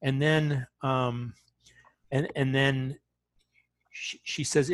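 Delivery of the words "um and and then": ordinary talk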